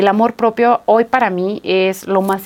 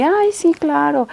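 higher, offhand "Ay, sí, claro"; mock indifference; 6 semitones higher, slower than usual. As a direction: toss it off as if nothing touched you. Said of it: "estaría muy neutral". (fingiendo indiferencia, agudo y desganado)

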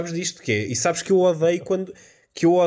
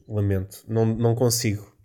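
ordinary talk, nothing marked

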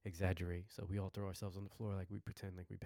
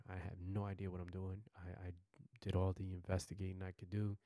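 ordinary delivery, nothing marked

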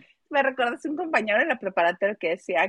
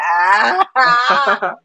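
anguished wail; 4 dB louder than usual. Pretend. Dramatic suffering over sad songs.

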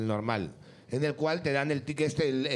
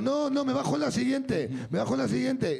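lightly acted higher-pitched pleading; mock-protest to the ticket inspector, pitch raised; insistent tourist; 6 semitones higher than usual. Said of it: "y con esta voz".

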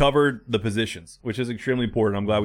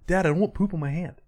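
lightly voiced little boy, pitched up; mock kid's protest in a higher voice; imitating his son; 7 semitones higher than usual. Son balking at wiping himself, imitated higher kid voice. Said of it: "he can't figure out the whole wiping".

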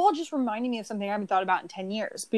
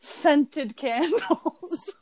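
laughing; 5 semitones higher, much slower than usual. Real, not acted. Laughing mock-dramatic announcement.

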